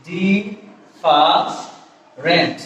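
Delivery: ডিফারেন্ট broken slowly into di, fa, rent; very slow ডিফারেন্ট with a pause after each syllable. Shown as a demonstration. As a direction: spell out ডিফারেন্ট by its three sounds. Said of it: "তিনটা syllable থেকে".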